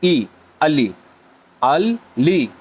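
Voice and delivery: अली then अल ली, drawn out syllable by syllable; slow sounding-out. Shown as a demonstration. Teacher demonstrating how the name is read, slow syllables. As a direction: spell out अल ली slowly, one part at a time. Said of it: "फिर लाम और".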